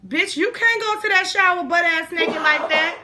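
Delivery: high-pitched incredulous telling-off; acted outraged objection; retold scolding. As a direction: tell a friend off in mock disbelief. Cellmates scolding her.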